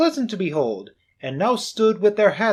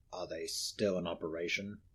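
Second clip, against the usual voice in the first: put-on cold old miser; 8 semitones lower, 14 dB quieter than usual. Scrooge telling the gentleman of Marley, low and cold. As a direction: answer flatly and coldly.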